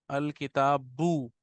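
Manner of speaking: अल किताबू drawn out slowly, syllable by syllable; teacher dictating अल किताबू very slowly; slow dictation. Shown as a demonstration. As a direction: spell out अल किताबू slowly for students to write. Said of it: "लिखेंगे हम अल किता".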